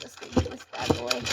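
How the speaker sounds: low growly tough-guy voice; gruff growl voice. Real, not acted. Growled aside to brush.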